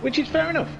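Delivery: fair enough squeaked up high in mock grace; mock magnanimous. Host joking that being smashed off track is fine, mock-gracious high. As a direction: pitch it up, pretending not to mind.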